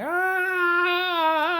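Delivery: long, very high wailing howl; imitated howl. Ice King holding Finn as he howls, imitated wail. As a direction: hold a drawn-out mock howl. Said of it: "starts howling".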